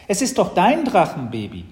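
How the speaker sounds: put-on high, loud child's protest; miffed little sister. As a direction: push the job back on your brother, stressing DEIN. Sister disowns responsibility.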